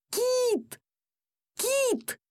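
very high-pitched desperate yell, held back in volume. A narrator's reading. Boy calls his pet being taken away, yelling. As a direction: call out the name in a high, anguished cry. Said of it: "Я орал".

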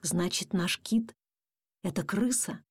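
slow, low musing question; thoughtful. A narrator's reading. Dad realises their pet is a rat, thoughtful. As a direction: let the realisation sink in slowly. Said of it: "задумчиво сказал папа".

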